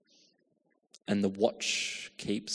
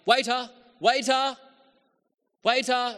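high, loud call across the room; calling the waiter; 13 semitones higher, 8 dB louder than usual. Retold or imitated. Hungry diner calling the waiter across the restaurant, loud high call. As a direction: shout for the waiter's attention. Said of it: "you start pointing out".

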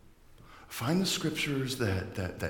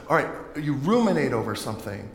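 usual speaking voice

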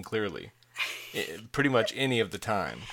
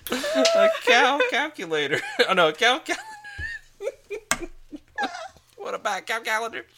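laughing; 5 dB louder than usual. Real, not acted. Laughing at his own mishearing.

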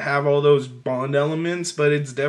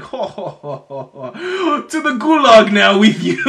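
laughing Soviet general; 5 semitones higher, 6 dB louder than usual. Retold or imitated. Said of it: "Comrade General, is like".

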